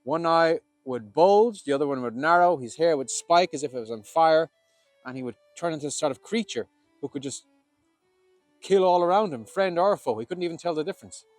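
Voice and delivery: intense storytelling. A narrator's reading. Describing battle frenzy.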